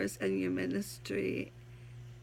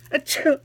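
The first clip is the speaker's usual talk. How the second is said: fake sneeze; 6 semitones higher, 11 dB louder than usual. Oh, excuse me.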